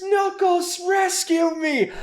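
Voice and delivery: high, drawn-out hammed-up plea; mock scream for help. Robotnik netted, screaming to Knuckles, in a high mock wail. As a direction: wail it like a cartoon villain begging. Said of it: "He screams".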